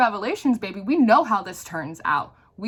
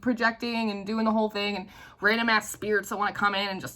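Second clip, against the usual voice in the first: ordinary talk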